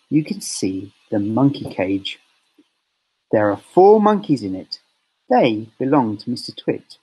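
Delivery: plain reading voice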